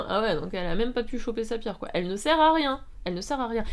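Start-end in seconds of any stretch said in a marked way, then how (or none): 1.9-2.8 raised, higher outburst of exasperation; exasperated. Real, not acted. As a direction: stress RIEN in loud exasperation. Exasperated verdict on heroine. (exaspération, voix haute et forte)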